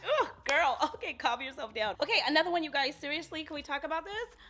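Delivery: can barely contain herself; overexcited. Real, not acted. To viewers, a loud squealing rush of excitement. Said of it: "I had to bring myself back".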